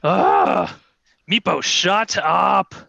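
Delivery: a slow, mildly exasperated brush-off; annoyed queen. Acted. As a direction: wave him off with a groan. Queen snaps at Meepo.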